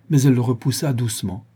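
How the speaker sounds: ordinary delivery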